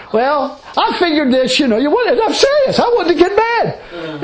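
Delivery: high, slowed confession broken by laughter; laughing. Retold or imitated. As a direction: admit it while laughing at yourself.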